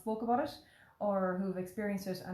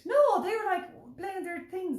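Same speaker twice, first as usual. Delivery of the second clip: amused high voice; 11 semitones higher, 6 dB louder, slower than usual. Real, not acted. Boys oblivious on plane.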